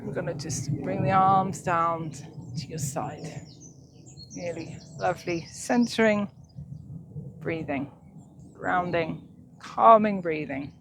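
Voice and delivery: slow, soft meditation voice; guided meditation. Acted. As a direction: ease them into calm breathing, slowly. Leads centering breathing meditation.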